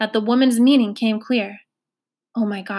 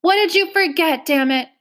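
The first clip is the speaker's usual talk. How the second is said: 1 impatient demand; 6 semitones higher, 4 dB louder than usual. A narrator's reading. Girl snaps at frantic woman.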